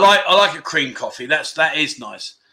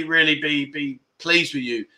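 usual speaking voice

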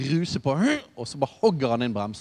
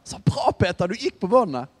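higher, louder cheer of praise; 7 semitones higher, 4 dB louder than usual. Retold or imitated. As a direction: cheer him on like a proud parent. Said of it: "jeg hadde mer tenkt sånn".

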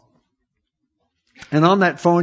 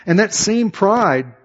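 ordinary delivery

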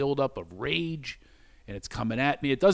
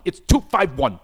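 "two five one" loud, each word punched; pedantic retold correction; 2 semitones higher, 7 dB louder than usual. Correcting the caller on the time, "two five one" punched loudly.

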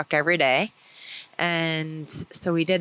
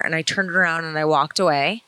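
ordinary talk, nothing marked

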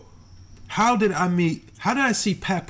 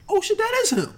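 surprised realization; 13 semitones higher than usual. Retold or imitated. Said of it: "But then I look again".

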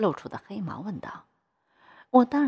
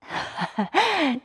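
high-pitched acted laugh; amused laugh; 9 semitones higher, 4 dB louder than usual. Bank boss pleased at her pleading, high laugh.

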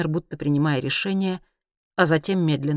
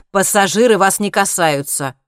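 louder, raised irritated retort; 4 semitones higher, 8 dB louder than usual. A narrator's reading. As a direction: cut them off with annoyance. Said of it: "раздражённо сказал Хайман". (раздражённо)

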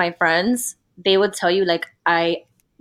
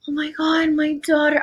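high-pitched mock adoring gush; gushing new mother; 7 semitones higher than usual. New mother seeing her baby, mock gushing. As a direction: parody a besotted mother's first reaction. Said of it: "I wasn't like".

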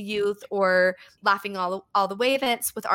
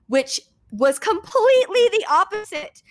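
excited; 10 semitones higher, 5 dB louder than usual. Real, not acted. Excited aside after praise.

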